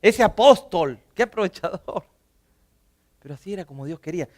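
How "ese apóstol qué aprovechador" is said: louder, a touch of disdain; scornful accusation. Retold or imitated. Churchgoers today mildly scorning the prophet as a freeloader. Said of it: "Hoy diríamos".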